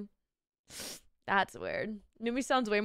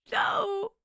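a sudden high shouted no; shocked no; 11 semitones higher than usual. Real, not acted. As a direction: cry out in dismay. Reacts to chat's sick news.

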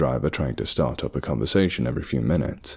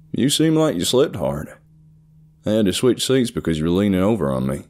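put-on higher-pitched country-accented voice; 5 semitones higher, 3 dB louder than usual. A narrator's reading. Seatmate teasing about sleep on the flight, country accent. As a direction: talk like a friendly country man. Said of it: "he spoke with a thick country accent".

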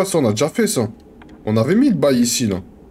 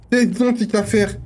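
high-pitched theatrical question; hammed-up bafflement, pitched high; mock puzzlement; 8 semitones higher than usual. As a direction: ask it in a high, playful voice.

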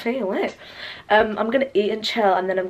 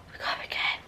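whisper; 11 dB quieter, much faster than usual. Real, not acted. Whispered to herself.

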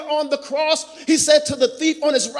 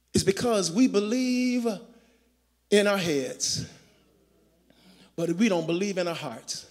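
soft intimate preaching; 3 semitones lower, 3 dB quieter than usual. Acted. Pastor quietly urging the congregation to believe, soft and low. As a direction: lean in and speak to their hearts.

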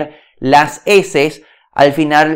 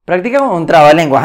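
fast, light restatement; quick repeat; faster than usual. Acted. Coach restating the tip to viewers, quick and brisk. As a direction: toss it off quickly the second time.

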